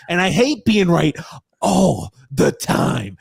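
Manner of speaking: lightly voiced droning aggrieved whine; Grump impression. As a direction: drag it out slowly, aggrieved. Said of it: "I'm trying to do my Grump impression".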